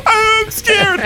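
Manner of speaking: put-on very high, small child voice; frightened little kid. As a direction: squeak it like a scared toddler. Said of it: "it'll be one of the kids who's like".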